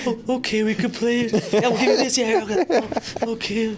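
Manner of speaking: put-on high kid voice; a little kid calming down with a bribe; lightly voiced toddler; placated kid.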